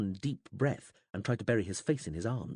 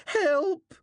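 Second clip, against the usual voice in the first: high, loud panicked scream; a desperate cry much higher and louder; 7 dB louder than usual. A narrator's reading. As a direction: scream for help. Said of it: "Uagan screamed".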